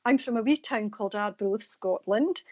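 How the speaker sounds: normal talking voice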